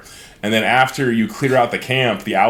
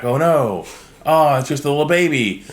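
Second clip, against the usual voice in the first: cooing; 4 semitones higher than usual. Retold or imitated. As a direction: coo at it like at a puppy. Cooing over the baby owlbear.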